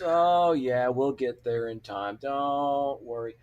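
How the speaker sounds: slow, drawn-out stalling; imitating an unhurried, teasing buddy; mock reassurance. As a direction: stretch it out to torment him. Stalling a desperate friend on purpose.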